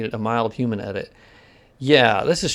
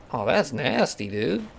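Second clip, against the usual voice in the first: ordinary delivery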